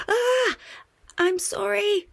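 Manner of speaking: high, loud startled "Ah!" then sorry; yelp, then blurt the apology. A narrator's reading. Muffin caught out and quickly saying sorry, startled.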